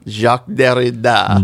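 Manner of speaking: slow French "Jacques Derrida", zhahk deh-ree-DAH; copying a French pronunciation of "Jacques Derrida"; French accent. Shown as a demonstration. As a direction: repeat the name the French way, taking it slow. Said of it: "How would you say that with, like, a French accent".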